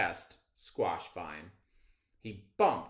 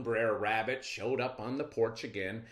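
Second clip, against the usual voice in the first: ordinary delivery, nothing marked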